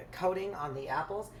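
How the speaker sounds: ordinary talk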